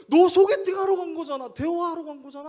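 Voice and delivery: high, loud, exasperated rant; angry scolding. Retold or imitated. Pastor scolding young man about his blind date, furious. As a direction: yell it at someone who just won't get it. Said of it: "저거 막 화를 내는 거예요".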